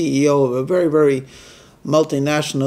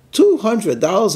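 incredulous; 5 semitones higher than usual. Acted. Customer hears the dentist's price, incredulous. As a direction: repeat the price as if you can't believe it.

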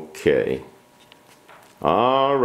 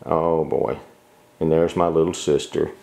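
usual speaking voice

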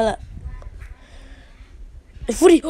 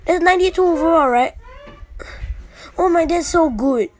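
high, loud and fast with delight; excited shouting; 6 semitones higher, 4 dB louder than usual. Real, not acted. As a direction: cheer the big pull. Great card pulled.

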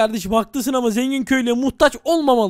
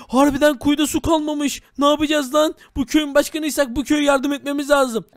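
ordinary talk

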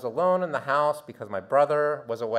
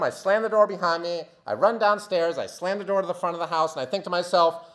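normal talking voice

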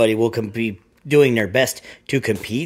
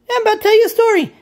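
much higher, louder and fast; eager Rosie voice; 5 dB louder, much faster than usual. Acted. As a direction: rattle it off high, like an excited little girl. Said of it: "And she's like".

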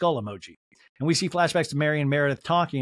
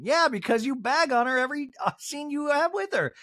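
high-pitched mock outburst; exasperated retort; 9 semitones higher than usual. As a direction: throw it back, voice pitched way up. Host mockingly retorts to Mary.

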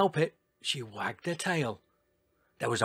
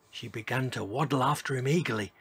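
usual speaking voice

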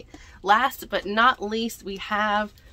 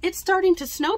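put-on excited child; 10 semitones higher, 6 dB louder than usual.

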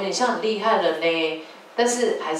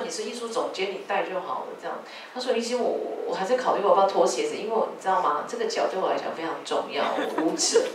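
soft, offhand mumble, brushing it off; lazy brother; 6 dB quieter, faster than usual. Retold or imitated. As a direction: imitate the brother shrugging her off quietly.

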